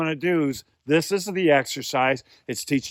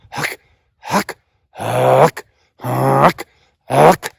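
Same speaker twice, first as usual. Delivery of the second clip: short throat-pushed huck calls; goose call huck; 6 dB louder, much slower than usual. Shown as a demonstration. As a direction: push the huck from the larynx, not blown. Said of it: "a muscle here that pushes it".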